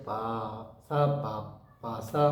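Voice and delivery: long "পা", short gap, "সা পা", then "পা সা"; stretch and gap. Shown as a demonstration. Teacher shows students the gap after it, "পা" stretched in rhythm. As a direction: draw out the first পা and pause before "সা পা". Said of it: "পা দিয়ে একটু gap".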